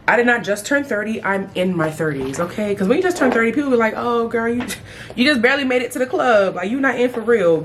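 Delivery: normal talking voice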